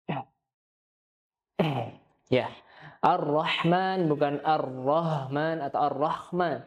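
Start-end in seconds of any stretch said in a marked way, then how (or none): 3.0-6.6 "Ar-Rahman" said three ways, ha, light ha, rasping kha; slow three-way h contrast; ha versus kha. Shown as a demonstration. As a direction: contrast the three h sounds slowly. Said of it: "jangan dibaca ha maupun kha".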